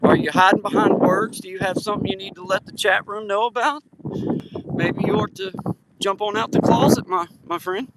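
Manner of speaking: a hammed-up mocking coax; mock teasing. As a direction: needle the viewer in a sing-song tease. Teasing a chat viewer.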